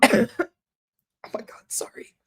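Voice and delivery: strained, voiceless apology after coughing; choking on spit. Real, not acted. Streamer choking on own spit apologises to viewers, whispery and strained. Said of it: "Choking on my own spit".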